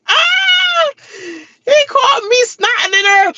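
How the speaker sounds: laughing shout. Real, not acted. Confessing she cried on camera, shrieking with laughter. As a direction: shriek it while cracking up.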